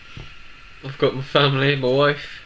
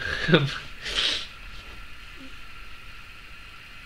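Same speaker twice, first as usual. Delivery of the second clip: tearful laugh; 4 semitones higher, 6 dB quieter than usual. Real, not acted. Laughing through tears.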